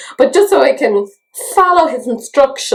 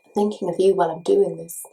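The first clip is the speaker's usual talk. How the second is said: lower, softer mock-creepy repeat; 4 semitones lower, 4 dB quieter than usual. Vlogger repeating a line to her friend, mock creepy. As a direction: say it like a creepy admirer. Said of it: "Which sounds kinda creepy".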